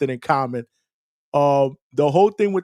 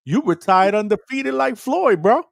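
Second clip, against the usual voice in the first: pitched way up, slow and delighted; excited high voice; 8 semitones higher, 3 dB louder than usual. Real, not acted. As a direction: sell it with a grin. Excited teasing suggestion.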